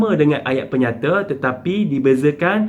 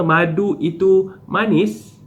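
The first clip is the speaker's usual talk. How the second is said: "Madu itu manis" drawn out slowly and higher, as a question; question intonation; 4 semitones higher, slower than usual. Shown as a demonstration. Teacher reading an example with a question mark, question intonation on "Madu itu manis". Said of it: "ayat penyata dengan tanda tanya pada akhir".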